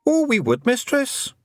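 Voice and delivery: high Scots little man, cheerfully frank; a wee Scottish thief owning up; Feegle voice. A narrator's reading. Feegle admits stealing to Tiffany, high and cheerful.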